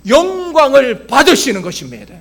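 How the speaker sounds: loud, emphatic sermon delivery; forceful preaching. Acted. Pastor to congregation on God defeating Goliath, forceful preaching. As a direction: proclaim it with full pulpit force.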